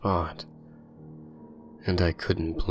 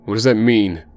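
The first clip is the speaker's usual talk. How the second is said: loud, higher alarmed question; 4 semitones higher, 8 dB louder, faster than usual. A narrator's reading. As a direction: ask it in rising alarm. Jacob asks, alarmed.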